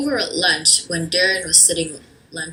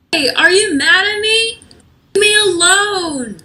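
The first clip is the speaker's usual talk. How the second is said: upset; 12 semitones higher, 6 dB louder than usual. Acted. Upset girl quarrels.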